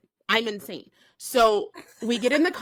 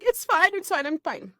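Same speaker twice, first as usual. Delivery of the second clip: forced fine; 8 semitones higher than usual. Retold or imitated. Forced fine while sobbing in car.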